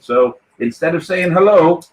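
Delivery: normal talking voice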